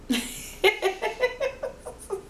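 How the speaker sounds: high-pitched burst of laughter. Real, not acted. Big laugh after chant.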